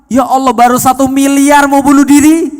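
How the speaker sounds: loud, high disbelief; incredulous exclamation. Retold or imitated. Preacher reacts to a billion debt.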